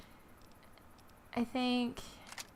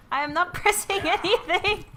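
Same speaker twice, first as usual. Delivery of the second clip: loud, higher, half laughing; laughing protest; 6 semitones higher, 8 dB louder than usual. Real, not acted. Protesting to the game.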